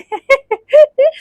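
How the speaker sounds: high, amused giggle; real laugh, pitched well up. Real, not acted. Joking to viewers that people call the dress a drum, high laugh.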